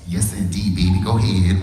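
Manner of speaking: low-pitched imitation of mama; mama's catchphrase. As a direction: say it low, like mama sizing someone up. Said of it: "and she said".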